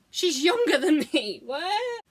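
put-on astonishment, ending on a high questioning note; mock disbelief. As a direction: exclaim it as if it can't be true.

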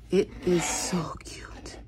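slow, breathy whisper of delight. Real, not acted. Fan cooing to viewers, slow whisper. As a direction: whisper it slowly, smitten. Gushing over the figure.

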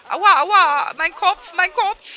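hammed-up yelling about a sore head; mock pained yell. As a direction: whine out the pain like a hurt cartoon character. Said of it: "Schrei doch mal nicht so laut".

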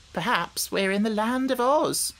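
usual speaking voice

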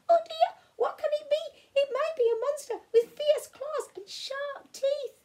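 frightened little mice squeaking over each other. Acted. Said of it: "the mice all started to squeak".

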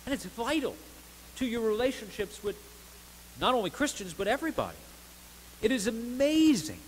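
full-throated sermon delivery; fervent preaching. Acted. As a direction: preach it out to the whole church. Preacher builds again.

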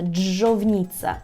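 "Джовніца" slow, holding the opening dzh; "Джовніца" in slow model pronunciation, long initial dzh; lengthened dzh. Shown as a demonstration. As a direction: draw out the first sound of "Джовніца". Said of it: "і він подовжується".

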